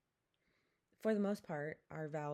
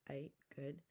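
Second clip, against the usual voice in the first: usual speaking voice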